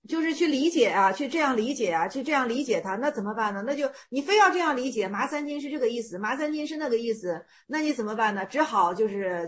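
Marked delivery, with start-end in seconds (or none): none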